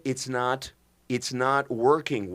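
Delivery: normal talking voice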